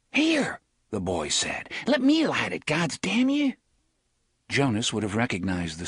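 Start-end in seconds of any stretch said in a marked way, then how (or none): 0.1-0.6 put-on young boy voice. A street boy hands over liver. 1.9-3.5 put-on high, pushy young boy; a brat grabbing the match; cocky street boy. Boy bullying his mate for the match, pushy high voice.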